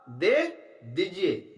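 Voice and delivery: slow, drawn-out दे दीजिए with a pleased lilt; slow pleased Indra. Shown as a demonstration. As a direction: stretch out दे दीजिए slowly, inwardly glad. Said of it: "खुश होकर कहता है".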